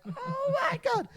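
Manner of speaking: high oh my God; laughing disbelief. Retold or imitated. Narrator reacting to the mess, high squeal. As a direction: squeal it, cracking up. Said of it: "And I'm like".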